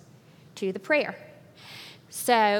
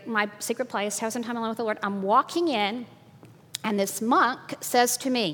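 normal talking voice

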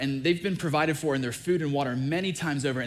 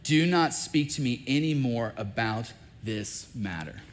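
ordinary delivery

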